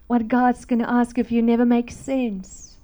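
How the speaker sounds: normal talking voice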